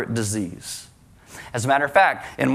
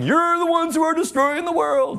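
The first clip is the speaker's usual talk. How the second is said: high, far-off mocking squeal; mocking falsetto accusation; mock accuser; 13 semitones higher than usual. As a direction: squeak it out like a caricature of an angry atheist. Mock atheist accusing a sticker.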